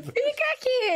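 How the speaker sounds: high-pitched retold command; ordem exaltada encenada. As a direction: replay an agitated demand at a partner. Wife replays ordering husband to stay. (ordem aguda, imitando uma briga)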